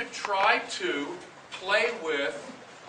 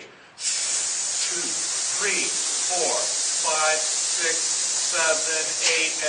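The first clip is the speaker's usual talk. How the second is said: counting through a loud hiss; hissing counts; 5 dB louder, much slower than usual. Shown as a demonstration. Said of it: "Now hiss".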